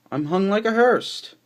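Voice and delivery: high, loud boast of a crowd; loud proclamation. A narrator's reading. Hobos boasting in the poem, loud proclamation. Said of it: "the assembly of hobos proclaimed".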